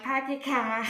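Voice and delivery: loud Boston shout, R's dropped; thick Bostonian accent. Shown as a demonstration. Student demonstrating dropped R's, loud Bostonian accent. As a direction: call out "car" as "cah", broad Bostonian. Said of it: "that thicker Bostonian accent where I start dropping my R's".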